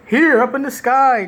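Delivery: heroic Superman announcer. Retold or imitated. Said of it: "this very Superman-esque".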